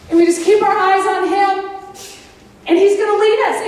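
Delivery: normal talking voice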